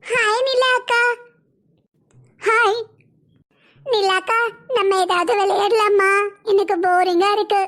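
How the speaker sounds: put-on high, childish little girl voice, slow and sweet. Small girl asking Nila akka to play, in a high childish voice.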